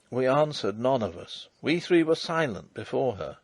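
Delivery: plain reading voice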